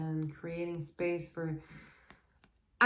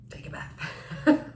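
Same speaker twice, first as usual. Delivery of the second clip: slow whispered aside; 5 dB louder, slower than usual. Real, not acted. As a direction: whisper it slowly. Joking advice.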